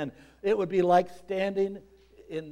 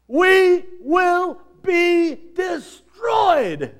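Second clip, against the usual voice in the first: slow, much higher shouted warning; preaching shout; 14 semitones higher, 12 dB louder, much slower than usual. Real, not acted. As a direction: drag it out like doom arriving. Climax of judgment warning.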